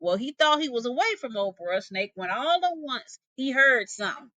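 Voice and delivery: usual speaking voice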